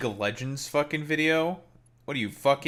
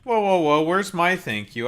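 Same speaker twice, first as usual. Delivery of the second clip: loud, higher mock outrage, whoa whoa whoa; mock indignant; 5 semitones higher, 6 dB louder than usual. Streamer to chat, demanding thanks, mock outrage. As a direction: play it hurt and demanding.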